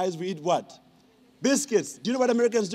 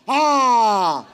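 loud drawn-out ah; gotcha shout; 3 semitones higher, 9 dB louder than usual. Real, not acted. To the audience who stole soap, a loud triumphant ah. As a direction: call the crowd out like you caught them.

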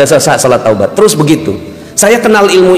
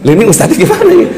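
surprised panic; 5 semitones higher, faster than usual. Retold or imitated. Preacher panics, ustadz has vanished.

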